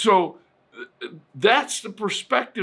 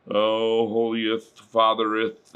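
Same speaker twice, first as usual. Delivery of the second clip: mock solemn prayer; 7 semitones lower than usual. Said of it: "very solemn and".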